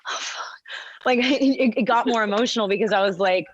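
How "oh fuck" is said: whispered. Retold or imitated. Awestruck moment seeing her friend.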